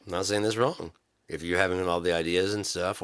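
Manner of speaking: ordinary talk, nothing marked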